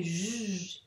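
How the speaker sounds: J as a drawn-out "zh"; sounded-out letter. Shown as a demonstration. Teacher answering which sound the letter makes, J held long. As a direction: hold the buzzing sound of J. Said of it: "elle fait quel son".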